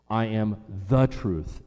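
slow, with "the" punched and drawn out; "the" stressed as the one and only. Shown as a demonstration. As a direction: lean hard on "the" before "truth".

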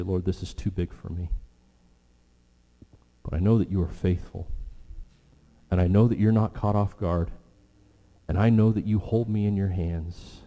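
hushed, soft prayer of trust; quiet prayer. Pretend. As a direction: pray it low, as if alone with God. Quoted prayer of surrender.

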